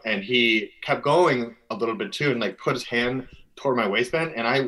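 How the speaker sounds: usual speaking voice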